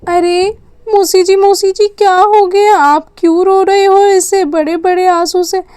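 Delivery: put-on a worried little child rushing to her aunt; small child. Little child calls crying aunt.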